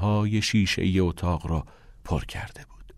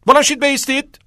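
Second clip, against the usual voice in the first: high, loud order to the class; commanding; 9 dB louder, faster than usual. Acted. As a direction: order everyone up in a raised voice. Teacher orders class to stand.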